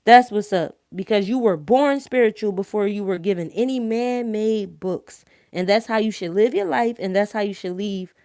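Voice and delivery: ordinary delivery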